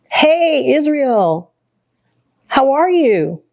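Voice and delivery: high-pitched, drawn-out happy hello; lifted, affectionate greeting; delighted phone greeting. Real, not acted. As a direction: greet a dear mentor on the phone. Greets mentor on a call.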